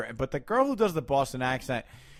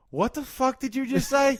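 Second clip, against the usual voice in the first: high, incredulous retort; taken aback; 11 semitones higher than usual. Retold or imitated. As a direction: react in mock disbelief, pitched way up. Taken aback by girlfriend's words.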